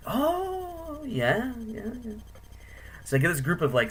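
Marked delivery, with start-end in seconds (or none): 0.1-2.1 high, drawn-out delighted "oh"; eager tourist impression. Tourist answering an offer of a picture, high mock delight. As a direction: imitate a tourist gratefully agreeing, pitched high.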